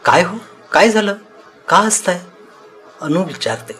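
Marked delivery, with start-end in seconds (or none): 0.0-2.2 higher, louder wifely query; acted baffled wife; puzzled questioning. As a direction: ask in surprise what's so funny. Wife questions her laughing husband.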